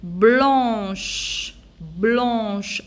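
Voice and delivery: "Blanche" drawn out with a long sh; stretched "Blanche", hushing sh at the end. Shown as a demonstration. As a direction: dictate "blanche" slowly, holding the final sh. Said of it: "c'est H, c'est ch".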